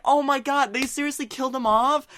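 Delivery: high-pitched, playful disbelief; retold dismay. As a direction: protest in hammed-up heartbreak. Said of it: "I was honestly sad too".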